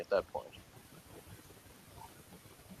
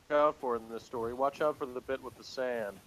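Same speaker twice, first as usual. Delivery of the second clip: ordinary talk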